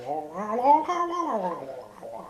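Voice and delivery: long garbled "wah" rising high, then falling; Murloc noise imitation. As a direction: burble like a Murloc, swooping up and down. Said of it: "Technically, they go".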